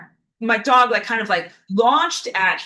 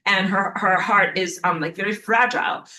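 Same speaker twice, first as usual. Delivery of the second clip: ordinary talk, nothing marked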